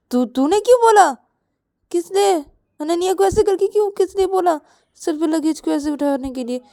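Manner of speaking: high, amused why-did-you questioning; teasing disbelief. Acted. Sister asks friend why she made her carry luggage, teasing. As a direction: tease her through giggles.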